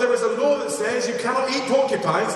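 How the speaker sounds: high-pitched storyteller's reproach; mock admonishing. Villager warning a porcupine hunter, mock admonishing. Repeated warning acted in fable.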